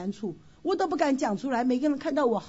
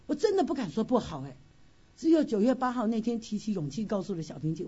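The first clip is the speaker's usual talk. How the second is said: ordinary talk, nothing marked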